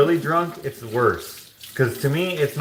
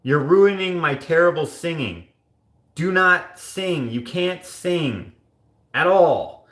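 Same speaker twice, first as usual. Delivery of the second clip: raised pitch, slow emphatic protest; mock pleading; 5 semitones higher, slower than usual. Telling a tone-deaf coworker to stop singing, mock pleading. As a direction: beg him to stop, stretching the words. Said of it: "And I'd be like".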